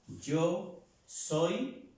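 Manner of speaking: usual speaking voice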